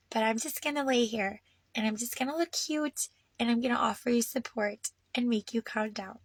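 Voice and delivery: soft, hushed imagined cat voice, partly whispered; voicing the cat. Voicing her cat comforting her, softly hushed. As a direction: lend the cat a gentle, caring voice. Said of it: "sits down like".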